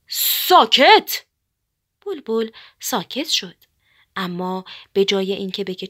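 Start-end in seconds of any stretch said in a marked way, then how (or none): 0.1-1.2 drawn-out, high, loud furious yell; angry louder shout. A narrator's reading. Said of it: "بعد عصبانی شد و بلندتر فریاد زد".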